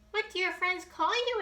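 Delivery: high small bunny voice; little bunny. Acted. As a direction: play a tiny eager bunny.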